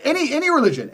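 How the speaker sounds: normal talking voice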